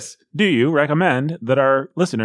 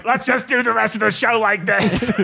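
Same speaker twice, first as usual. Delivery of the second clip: put-on higher, weird robot accent; 5 semitones higher than usual. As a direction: talk like a glitching robot with an accent.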